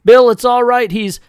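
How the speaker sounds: high, loud cry to stop them; urgent plea. Acted. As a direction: cut in high and loud to calm him. Claire calming the fight.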